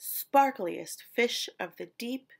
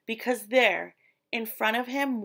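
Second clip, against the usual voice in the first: usual speaking voice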